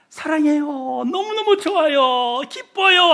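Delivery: high, loud, drawn-out declarations of love; acted-out overjoyed praise; gushing affection. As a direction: gush adoringly, voice rising to a joyful peak. Acting out loving words to a neighbour.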